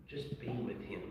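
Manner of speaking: quiet, gentle reassurance; soft fatherly advice. Retold or imitated. Father gently advising his grieving son, quiet and tender. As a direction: say it low and tender. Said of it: "And I said".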